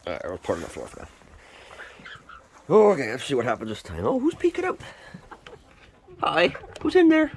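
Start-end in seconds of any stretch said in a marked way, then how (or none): 4.0-7.3 high, sweet voice to chicks. Real, not acted. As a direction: coo at the peeking chick. Baby talk to chicks.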